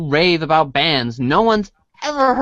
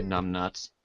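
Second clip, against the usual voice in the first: low, soft jab under the breath; muttered insult; 8 semitones lower, 12 dB quieter than usual. Real, not acted. Reader grumbling at the game, low and soft. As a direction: toss off the insult to himself.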